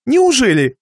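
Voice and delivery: высокий удивлённый вопрос; переспроси с печальным удивлением; удивление хозяина. A narrator's reading. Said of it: "удивился печальный хозяин". (удивлённо, повыше)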